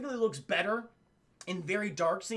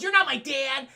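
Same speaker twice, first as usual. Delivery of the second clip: loud, higher bratty retort; mock defiant kid; 5 semitones higher, 6 dB louder than usual. Acting out Tim defying the lawyer, mock defiant. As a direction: imitate a kid talking back.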